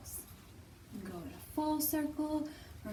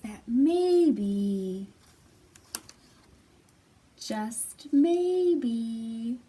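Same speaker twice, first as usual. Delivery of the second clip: story narration; 7 dB louder than usual. A narrator's reading. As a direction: draw out the "maybe" to build suspense. Reads story aloud.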